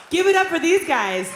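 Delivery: rousing call-out. Real, not acted. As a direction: whip up applause for the other band. Calling for applause from the crowd.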